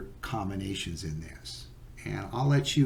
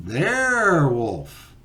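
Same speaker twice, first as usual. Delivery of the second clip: loud, high, stretched-out call; drawn-out joke reply; 5 semitones higher, 8 dB louder, much slower than usual. Acted. Other walker answers as the joke's punchline, drawn-out call. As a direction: stretch the first syllable out long and loud. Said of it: "the other person says".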